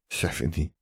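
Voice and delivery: muttering. A narrator's reading. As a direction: mutter it under your breath. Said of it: "the young wizard muttered".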